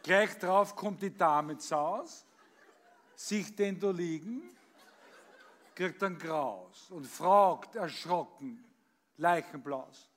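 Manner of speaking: lively storytelling recital, acting out each voice; comic poem recitation. Reciting a comic poem for the birthday audience, performed recital.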